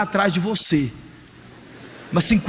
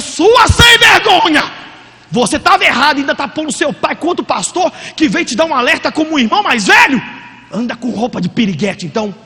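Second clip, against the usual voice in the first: furious scolding; 4 semitones higher, 5 dB louder than usual. Retold or imitated. Mother scolds her daughter for turning father against pastor, furious shout. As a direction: tear into the daughter like an enraged mother. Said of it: "A mulher foi doida na menina".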